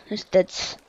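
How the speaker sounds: ordinary talk, nothing marked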